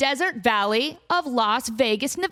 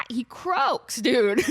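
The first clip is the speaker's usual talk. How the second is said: normal talking voice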